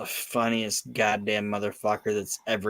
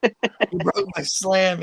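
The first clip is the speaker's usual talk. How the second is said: high, slow wounded whine; heartbroken whine; 8 semitones higher, 4 dB louder than usual. Retold or imitated. Podcast host imitating Owen Hart over his snapped trophy, high wounded whine. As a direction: whine it like a hurt child. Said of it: "And Owen's just is like".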